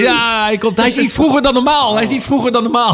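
high-pitched, thrilled exclamations; excited live reaction. Real, not acted. Host sees the train come early, excited live reaction. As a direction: announce it excitedly, voice well up. Train arrives earlier than expected.

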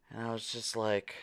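normal talking voice

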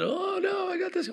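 put-on high whiny kid voice; refusing child. Pastor voices his child hiding the hurt, high and whiny. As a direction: whine it way up high.

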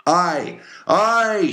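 very high, loud answering cheer; crew's shout. Acted. Harpooneers and seamen answer their captain, high shout. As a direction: holler it back eagerly. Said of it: "shouted the harpooneers and seamen".

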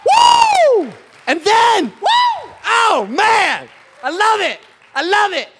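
high, loud burst of delight; thrilled whooping. Acted. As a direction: whoop and exult at your own creation. Preacher acting out God's delight.

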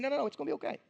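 Jesus gently calming his worried followers; comforting. Retold or imitated. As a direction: soothe frightened friends gently. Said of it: "Jesus comforting his followers".

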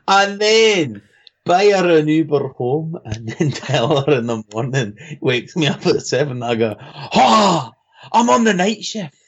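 put-on Scottish brogue, getting exclamatory; Scottish accent. Shown as a demonstration. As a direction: play out the tale in Scottish. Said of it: "in a Scottish accent".

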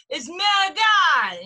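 mock-shouted, high and slow "manigot"; exaggerated Italian-American scolding impression; aunt's loud correction. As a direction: holler it like an outraged Italian aunt. Mimicking disapproving aunt's correction.

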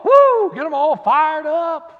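high, loud whoop; a hammed-up cheer; mock woo. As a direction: whoop like a fired-up crowd. Imitating fired-up congregation.

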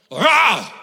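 loud, high snarling roar. Shown as a demonstration. Preacher demonstrating the Greek word, loud snarling roar. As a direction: growl it out like an angry beast. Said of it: "is to snarl".

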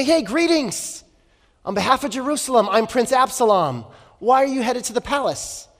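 high-pitched, chummy greeting; lightly hammed-up glad-handing welcome; schmoozing prince. Charming prince greeting arrivals at the city gate, schmoozing. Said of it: "then he would schmooze them".

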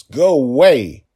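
slow, loud, furious command; a desperate father's loud demand; angry shout. A narrator's reading. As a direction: drag the words out in a roaring order. Father banishes the giant world.

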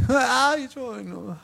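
high whine fading to a mumble; whiny refusal. Retold or imitated. Exhausted parent at night, whining. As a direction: groan a sleepy no.

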